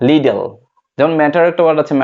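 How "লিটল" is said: 'লিডল', little said with a d; American d sound. Shown as a demonstration. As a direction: say 'লিডল' as LEE-del. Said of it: "American বলে".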